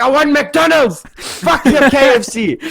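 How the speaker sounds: imitating a friend's high-pitched drunken yelling; jokingly angry. Friend who wants McDonald's arguing back, jokingly angry. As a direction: yell it in mock outrage. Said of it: "starts getting, like, jokingly angry".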